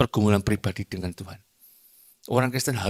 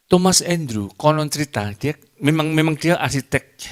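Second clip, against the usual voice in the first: emphatic storytelling; 6 semitones higher, 6 dB louder than usual. Acted. Preacher launches story.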